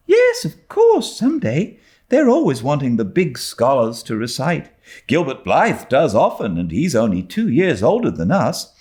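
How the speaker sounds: a schoolgirl answering her friend confidently; high bright girl's reply; young girl Diana. A narrator's reading. Diana voiced in story.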